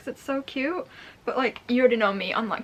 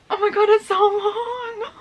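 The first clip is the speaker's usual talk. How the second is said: crying out; 9 semitones higher, 8 dB louder, slower than usual. Real, not acted. Emotional over a long letter.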